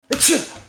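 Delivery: loud fake sneeze; a hammed-up sneeze; mock sneeze, high and loud. Fake sneeze in roast bit.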